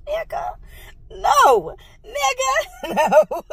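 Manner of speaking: high, laughing disbelief; outraged laughing no; incredulous refusal. Real, not acted. Refusing the prophet's $444 ask.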